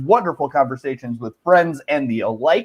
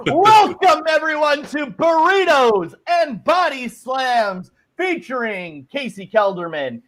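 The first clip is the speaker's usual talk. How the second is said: announcer; 7 semitones higher than usual. Acted. Show opener in announcer style.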